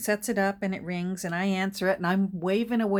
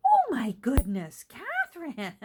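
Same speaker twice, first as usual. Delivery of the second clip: mother's delight; 7 semitones higher, 6 dB louder than usual. Retold or imitated. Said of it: "And then she'll go".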